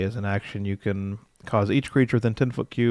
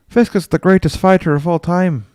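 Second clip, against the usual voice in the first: loud, higher, fired-up praise; excited hype; 7 semitones higher, 8 dB louder than usual. Real, not acted. Streamer gushing about his favourite fighter, loud and excited. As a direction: sell it like a fan gushing.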